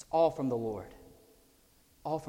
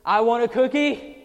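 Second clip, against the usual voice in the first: put-on loud, high demanding child's yell; child screaming; 10 semitones higher, 13 dB louder than usual. A child screaming at the parent for a cookie, loud and high. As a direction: scream it like a kid denied a cookie.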